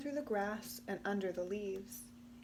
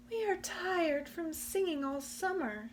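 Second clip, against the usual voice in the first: higher, weary little voice; tired crickets; 8 semitones higher than usual. Acted. The crickets declining Possum's invitation, weary and high. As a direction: sound worn out from summer.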